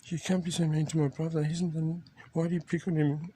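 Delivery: high-pitched puppet scolding a bully; puppet Lefty voice. Pretend. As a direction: squeak it out as an indignant hand puppet. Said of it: "and then Lefty says".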